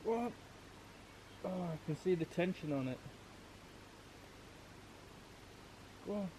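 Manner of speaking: hushed whisper so fish aren't spooked. Real, not acted. Whispering while fishing at night.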